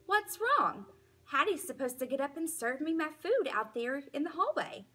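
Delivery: high, fast puzzled yelp; confused outburst. A narrator's reading. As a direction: blurt it out, baffled. Dog confused and upset.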